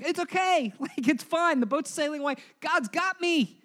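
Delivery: hammed-up carefree Jonah, high and chuckling; mock cheerful denial. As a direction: play Jonah pretending all is fine while sinking. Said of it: "can you imagine Jonah being in the water, being like".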